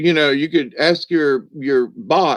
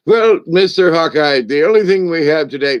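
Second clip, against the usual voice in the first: a lightly voiced James Bond, raised and louder; robot butler doing a clumsy, eager hint of James Bond; James Bond imitation; 5 semitones higher, 4 dB louder than usual. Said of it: "try to sound like James Bond".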